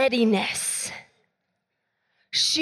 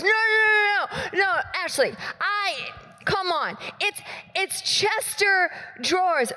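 high, pleading friend voice; whiny protest; 5 semitones higher than usual. Retold or imitated. Friend protesting to pastor in a high whine.

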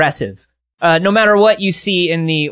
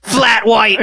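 put-on Australian catchphrase; hammed-up Australian accent; 3 semitones higher than usual. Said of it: "Can we promise not to do Australian accents".

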